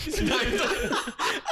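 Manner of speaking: high burst of real laughter; laughing. Real, not acted. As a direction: burst out laughing at your own impression. Laughing at the prank story.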